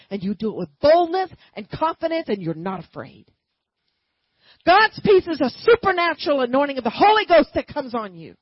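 loud, driving sermon delivery; booming preacher proclaiming to the congregation; full-force preaching. Acted. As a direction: preach it out with fire.

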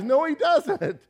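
usual speaking voice

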